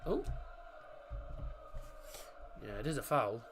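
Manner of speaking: ordinary talk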